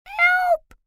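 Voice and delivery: tiny high cry for help; tiny fairy voice. A narrator's reading. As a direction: call out small and desperate from inside. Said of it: "Rachel heard a tiny voice".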